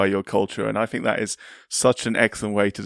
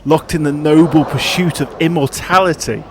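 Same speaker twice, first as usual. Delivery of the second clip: dramatic scripted intro read over music; documentary narration; 3 semitones higher, 4 dB louder than usual. Acted. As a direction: read it like a sports documentary voiceover. Scripted podcast cold open.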